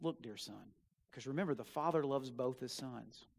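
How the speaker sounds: low, very soft tender pleading; loving father. A narrator's reading. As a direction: coax him gently like a father. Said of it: "his father looked at him and says".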